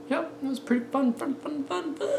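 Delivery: very high, soft, playful voice; silly squeak. Pretend. Joking that it was pretty fun, silly squeak. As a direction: shrink to a tiny high squeak.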